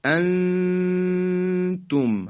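«انتم» with the noon drawn out in a long nasal hum; very slow «انتم», long ghunna before the ت; ikhfa. Shown as a demonstration. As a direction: hold the hidden n of «انتم» on one high, level note. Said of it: "همراه با مکث و کشش".

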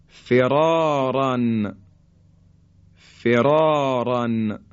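words with thick emphatic ra, drawn out slowly; heavy ra. Shown as a demonstration. Teacher reciting heavy ra examples slowly. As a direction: recite each word with a full heavy ra.

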